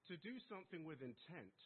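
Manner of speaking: mock-posh British accent for the judge; thick British accent. To the traffic judge, in a put-on thick British accent. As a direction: clip it like a proper English gent. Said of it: "my thickest British accent".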